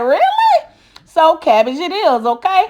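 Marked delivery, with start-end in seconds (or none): 0.0-0.7 mock disbelief. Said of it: "I be like".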